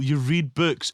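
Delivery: ordinary talk, nothing marked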